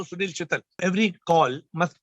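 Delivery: ordinary delivery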